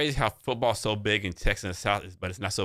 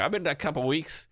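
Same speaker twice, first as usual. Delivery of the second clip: put-on Southern accent; 8 semitones higher than usual. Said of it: "thick ass accent".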